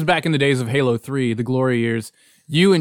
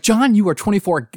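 higher-pitched mock scolding; retold angry scolding; 6 semitones higher, 3 dB louder than usual. Retelling scolding John from the bed, starting to get angry. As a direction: start an exasperated retold tirade. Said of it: "I'm getting angrier".

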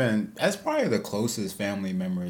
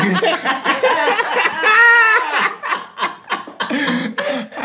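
long helpless high laugh; big laugh; 8 dB louder than usual. Real, not acted. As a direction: break up laughing. Laughing hard at own story.

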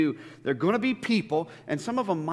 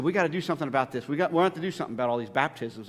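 hurried complaint; 3 semitones lower, much faster than usual. Retold or imitated. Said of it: "she said".

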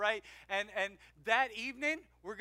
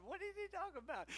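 confused listener; 8 semitones higher, 9 dB quieter than usual. Retold or imitated. Voicing confused congregation members.

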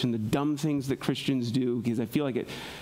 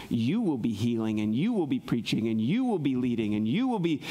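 each "you" lifted in pitch; rhythmic list peaking on each YOU; stress on YOU; 4 semitones lower than usual. Retold or imitated. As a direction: punch every you, dropping low between. Listing what disciples themselves will do.

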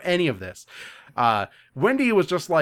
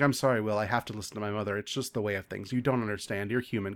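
soft, low resigned apology; lightly acted quiet regret; mildly sad; 5 semitones lower, 7 dB quieter, faster than usual. Deanna apologizing to Will, understated sadness. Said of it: "Deanna appears sad in the background".

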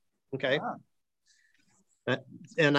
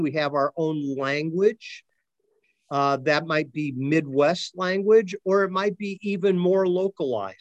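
normal talking voice

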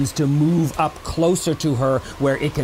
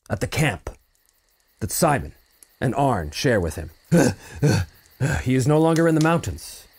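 ordinary delivery

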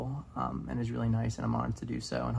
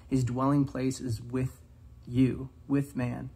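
slow emphasis; slower than usual. Shown as a demonstration.

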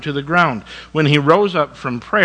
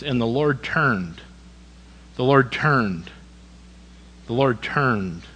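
And the Lord turned, each word dwelt on slowly and falling; slow, weighty repetition; 4 dB quieter, slower than usual. Shown as a demonstration. Preacher repeating the verse to the congregation, slow and weighty. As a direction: linger on Lord turned, let it sink in. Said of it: "listen to this".

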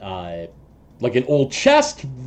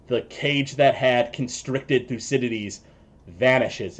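ordinary talk, nothing marked

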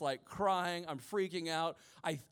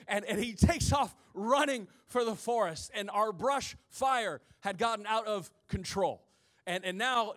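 usual speaking voice

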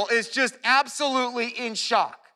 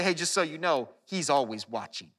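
low, hushed mock warning; parental warning; 6 semitones lower, 5 dB quieter than usual. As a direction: imitate a parent's quiet caution. Acting out parents' watchful warning.

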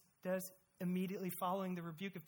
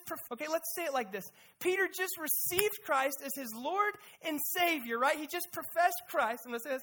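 usual speaking voice

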